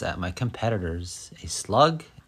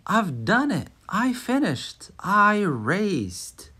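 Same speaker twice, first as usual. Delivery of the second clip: excited; 10 semitones higher, slower than usual. Acted. Tortoise finishes the race.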